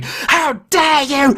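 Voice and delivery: high, drawn-out mock shriek; heated outrage. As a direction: imitate an outraged mum confronting someone. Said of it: "extremely heated arguing".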